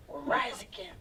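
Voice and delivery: hushed whisper of the slogan. Retold or imitated. Kid joining in secretly, whispered pledge.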